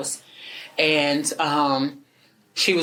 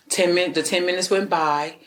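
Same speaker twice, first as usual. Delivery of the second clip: ordinary talk, nothing marked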